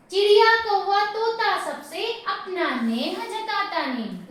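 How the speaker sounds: lifted verse-reading voice; poem recitation. Shown as a demonstration. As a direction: recite it out to a class, dropping at the end.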